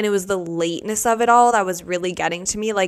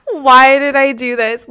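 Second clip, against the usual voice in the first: panicked cry; 5 semitones higher, 9 dB louder than usual. Retold or imitated. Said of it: "And I'm like".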